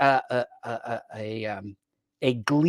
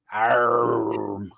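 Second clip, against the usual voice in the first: pirate growl arr; 2 semitones lower than usual. Pretend. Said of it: "He did it in pirate voice".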